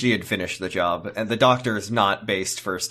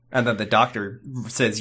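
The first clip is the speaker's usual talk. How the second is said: usual speaking voice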